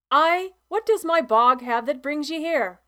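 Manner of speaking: put-on loud, grumbling old farmer calling back; a cranky old man shouting from the barn; gruff old farmer. A narrator's reading. Mister Treadwell shouts back from the barn, grumbling and loud.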